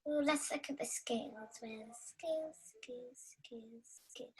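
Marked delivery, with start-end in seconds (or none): 1.6-4.3 searching, voice sinking to a whisper; trailing off into a whisper; fading to a whisper. Real, not acted. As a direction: mutter it away to nothing.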